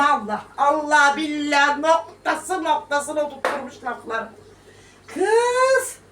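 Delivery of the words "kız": sharp high exclamation; exclaim. Real, not acted. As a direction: call out in amazement. Exclaiming about Halime.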